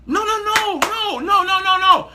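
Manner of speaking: high, ringing repeated refusal; preacher's high insistent no; emphatic no. Real, not acted. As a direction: shut the idea down with rising fervour. Emphatic rejection of the idea.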